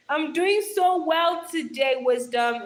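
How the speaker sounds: loud, drawn-out megaphone call; megaphone effect. Shown as a demonstration. Streamer calling out to Wisdom through a hand, megaphone-like. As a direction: project it as through a makeshift megaphone. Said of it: "sounds like I'm actually using a megaphone".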